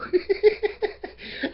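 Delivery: breathy high burst of real laughter; high giggle. Real, not acted. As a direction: giggle helplessly at yourself. Laughs at own remark.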